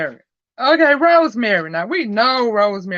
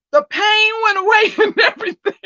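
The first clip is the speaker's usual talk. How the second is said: laughs through it, higher and quicker, x1.4; giggling as the pain story lands; laughing; 10 semitones higher, faster than usual. Real, not acted. Telling viewers the pain vanished, laughing.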